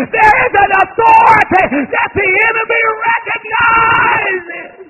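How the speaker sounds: loud, high sermon climbing to a shouted peak; full-force preaching. Real, not acted. Preacher telling the church there's power in prayer, full-force shouted preaching. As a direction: preach it at full voice, stretching the last words.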